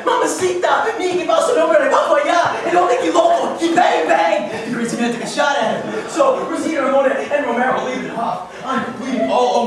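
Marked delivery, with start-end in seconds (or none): 0.0-4.7 loud, high, frantic Spanish gibberish; comic Spanish tirade, rising in alarm; mock Spanish. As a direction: cry out in hammed-up Spanish panic. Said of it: "Romero, runs downstairs and tells Rosita and Ramona".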